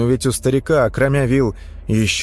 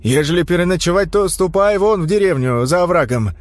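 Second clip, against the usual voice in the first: raised, higher voice calling out; shouting at trespasser; 6 semitones higher than usual. A narrator's reading. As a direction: call out to the stranger, raised. Old man calls to stranger.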